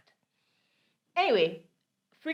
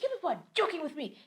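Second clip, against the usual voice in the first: shouting landlord; 10 semitones higher than usual. Retold or imitated. Landlord shouting at tenant from downstairs, high furious yell. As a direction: yell that they're joking with her. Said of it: "And she's shouting".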